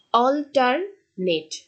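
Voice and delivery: Alternate said slowly, weight on alter; stress demo. Shown as a demonstration. Teacher showing students where the stress falls, slow and deliberate. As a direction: draw out alternate, leaning on the first part. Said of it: "অল্টারের উপর কি দেওয়া হচ্ছে, stress".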